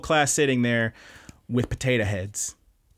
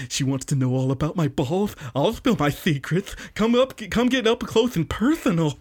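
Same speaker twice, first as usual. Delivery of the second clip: lisping creep, climbing higher in pitch; Mineta, creeper mode; 4 semitones higher than usual. Retold or imitated. Mineta drops the line of the century, lisping creeper. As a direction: slur the s's into th, building to a high leer. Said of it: "He's went full on creeper mode".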